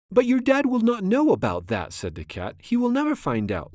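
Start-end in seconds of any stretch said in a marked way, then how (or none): 0.1-1.9 high-pitched cat character, held up high; storybook cat voice. A narrator's reading. Cat reassures the children in story. 2.6-3.7 high, lightly amused storybook cat; airy high-pitched cat character; laughing. A narrator's reading. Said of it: "laughed the Cat in the Hat".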